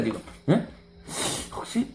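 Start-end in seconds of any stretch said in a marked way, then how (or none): 1.5-1.9 softer, sly taunt; sly teasing. Acted. Blind man teasing the Pharisees, softer and sly. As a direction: drop your voice and needle them. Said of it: "약을 팍 찔른 거야".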